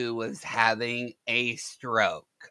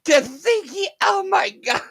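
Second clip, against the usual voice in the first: high, shouted through laughter; crying with laughter; 3 dB louder than usual. Real, not acted. Streamer to chat, crying with laughter. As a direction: lose it completely.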